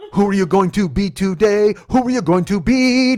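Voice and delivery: high, slow, loud cooing; mock pet talk. Driver to passenger as if a cat, stretched baby talk. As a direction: draw out each question as if to a pet. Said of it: "It's like talking to a cat".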